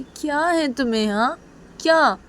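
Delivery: high-pitched, irritated questioning; annoyed, voice jumping up; exasperated. Acted. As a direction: snap at someone who keeps pestering. Ruhi irritated at Piyu's insistence.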